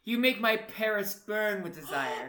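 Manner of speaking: slow, loud, hammed-up declaration; love letter reading. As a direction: read it out like a grand love letter. Reads the silly love letter aloud.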